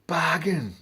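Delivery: punchy loud "Bargain!"; mock triumphant shout. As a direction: bark it out like a market trader. Acted reply in haggling story.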